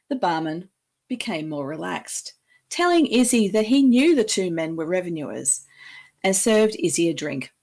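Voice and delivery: ordinary delivery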